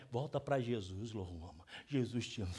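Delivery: a pastor mocking a meek, gentle way to talk to his daughter; mock gentle plea. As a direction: play the too-soft parent he is not. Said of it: "o jeito de eu falar não é".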